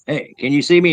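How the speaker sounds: mock taunt. Acts out taunting the blinded Gugway.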